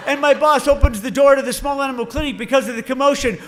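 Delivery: normal talking voice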